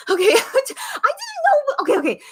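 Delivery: high, teary laugh breaking into words; laugh-cry, overwhelmed and touched; real teary laugh; laughing through tears. Real, not acted. Touched by late-night viewers.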